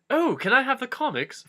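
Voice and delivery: high, bright-eyed hobbit asking; Frodo voice. Acted. Frodo begs Gandalf for the comics, high pleading voice. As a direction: ask like an eager little kid.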